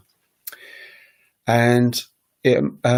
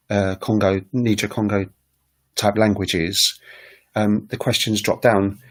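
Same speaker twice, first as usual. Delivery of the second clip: ordinary delivery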